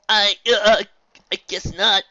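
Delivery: put-on stammering young colt; nervous colt. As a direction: cave in awkwardly. Colt caves in.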